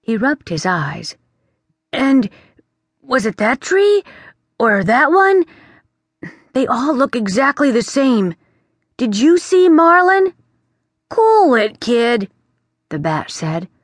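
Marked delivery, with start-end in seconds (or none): 1.9-10.3 put-on higher, louder worried boy; a young prince rattled and insistent; anxious boy prince. Prince tells the bat Gracie vanished, worried and higher. 11.1-12.3 higher, louder offhand bat voice; breezy bat. Acted. The bat tells the prince to calm down, offhand.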